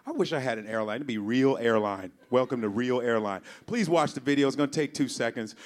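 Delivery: usual speaking voice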